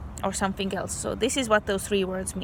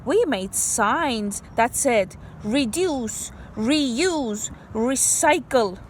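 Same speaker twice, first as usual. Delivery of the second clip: story reading; 2 semitones higher, much slower than usual. Acted. Reads story aloud.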